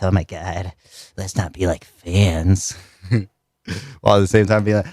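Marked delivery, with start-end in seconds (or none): 0.0-2.8 lower, slow, hushed cool voice; playing it cool. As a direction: say it slow and low, acting unimpressed. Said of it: "We were like, we were like".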